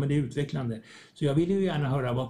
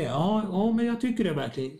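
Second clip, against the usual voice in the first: eager reply; 7 semitones higher than usual. Retold or imitated. The thanked person answers back, mock eager and pitched higher.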